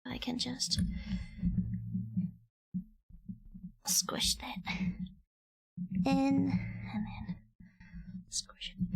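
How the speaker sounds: breathy swearing ASMR whisper. Pretend. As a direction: whisper the craft steps softly. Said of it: "my swearing A S M R".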